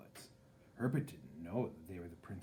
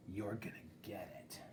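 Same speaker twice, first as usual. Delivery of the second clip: whispered threat; 10 dB quieter than usual. A narrator's reading.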